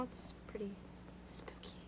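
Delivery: soft whispered spooky aside; whispering. Real, not acted. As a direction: hush it like a ghost story. Eerie room remark.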